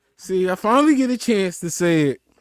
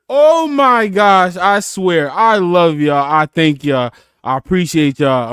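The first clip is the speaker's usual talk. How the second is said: loud, drawn-out, heartfelt outburst; overwhelmed gratitude; 5 dB louder than usual. Real, not acted. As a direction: gush thanks after laughing till you cry. Overcome with laughter, thanking listeners.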